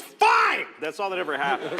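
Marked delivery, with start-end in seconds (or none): 0.0-0.7 high, loud exasperated shout; angry giving-in. Retold or imitated. One parent ending an argument with the other, acted angry shout. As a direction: explode on the single word. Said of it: "one of them goes".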